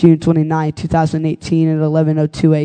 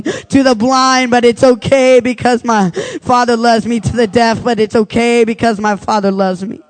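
higher preacher's litany with a refrain; altar call; 5 semitones higher than usual. Acted.

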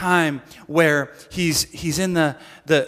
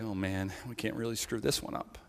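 nervous murmur; 8 semitones lower, 16 dB quieter than usual. Retold or imitated. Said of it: "everyone's like".

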